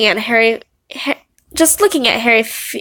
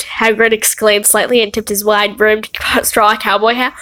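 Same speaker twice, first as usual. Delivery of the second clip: normal talking voice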